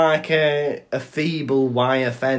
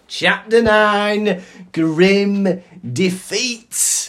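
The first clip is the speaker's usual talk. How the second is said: loud, high, very drawn-out proclamation; chapter announcement; 8 semitones higher, 6 dB louder, much slower than usual. Acted. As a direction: announce the chapter with theatrical grandeur.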